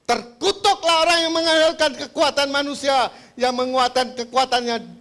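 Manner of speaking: high, loud pulpit denunciation; thundering preacher. Retold or imitated. Imitated preacher warns the congregation, high and thundering. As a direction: pronounce the curse at full force.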